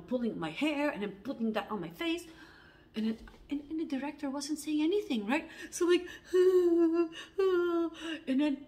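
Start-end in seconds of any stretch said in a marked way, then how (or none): 6.1-8.2 loud, high wailing sobs; high acted crying; mock sobbing. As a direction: re-enact bawling in breathy bursts. Re-enacted crying after the scene.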